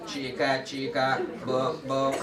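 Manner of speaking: low, even robot chant. Shown as a demonstration. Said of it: "say it like a robot".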